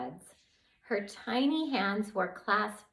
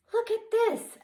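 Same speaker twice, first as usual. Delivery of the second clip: high, bright find; excited look at this; excited discovery; 5 dB louder than usual. Acted. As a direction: call out a discovery. Finds hidden vial.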